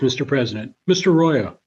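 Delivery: usual speaking voice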